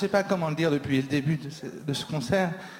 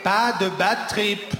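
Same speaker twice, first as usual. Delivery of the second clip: loud raised call to the crowd; 5 semitones higher, 4 dB louder than usual. Real, not acted. Comic before stage-diving calling to the crowd, loud. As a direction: call it out over the noise.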